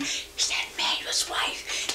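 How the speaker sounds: a hushed, breathy aside; whisper. Retold or imitated. Fishermen whispering about the newcomer, hushed whisper. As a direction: whisper it so the bride won't hear. Fishermen eyeing the new bride.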